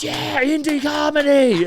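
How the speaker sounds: very high, loud, drawn-out yell; imitated crowd hollering in support; mock crowd cheer. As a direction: whoop like a rowdy audience, stretching it out.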